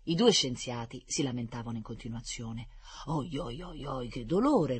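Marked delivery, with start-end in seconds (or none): 3.1-4.8 groaning. Acted. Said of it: "ruggiva il primo".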